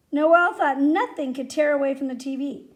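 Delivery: ordinary delivery, nothing marked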